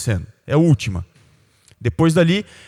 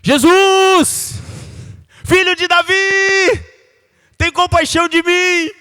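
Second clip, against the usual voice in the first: high, slow crying out; desperate shout; 4 dB louder, slower than usual. Retold or imitated. The blind beggar crying out to Jesus over the crowd, high and loud. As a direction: cry out to be heard. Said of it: "Ele gritava mais".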